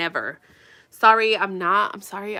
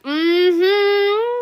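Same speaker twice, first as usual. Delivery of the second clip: long rising, loud hum; imitated way of talking; 10 semitones higher, 5 dB louder than usual. Vlogger showing how someone talks, exaggerated rising hum. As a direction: draw the hum out, climbing high. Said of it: "You talk like that".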